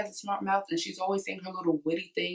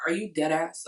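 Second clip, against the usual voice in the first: ordinary talk, nothing marked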